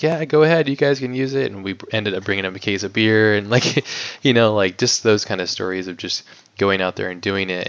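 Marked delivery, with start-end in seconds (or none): 0.0-1.5 higher, easygoing woman giving the go-ahead; imitating the homeowner's daughter. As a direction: lift the voice to quote her granting permission. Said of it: "she was like".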